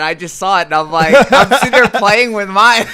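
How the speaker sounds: loud, high-pitched laughing delivery; real amusement bursting through the sentence. Real, not acted. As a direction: laugh your way through the words, loudly. Amused interruption about the ring.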